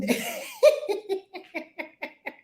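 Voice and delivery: light breathy laugh; high giggle. Real, not acted. Host giggling to her chat.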